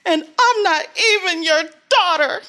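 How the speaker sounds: retold tearful cry, high and loud; crying outburst. Girl fighting with her mom, crying out loud. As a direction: blurt it through tears at your mom. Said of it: "And I'm crying and I'm bawling".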